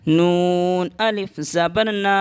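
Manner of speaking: slow letter-by-letter spelling; teacher spells out نا slowly. Shown as a demonstration. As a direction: spell نون زبر نا slowly. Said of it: "ایک الف کے برابر کھینچ کر پڑھتے ہیں".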